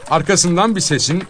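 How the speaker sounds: ordinary delivery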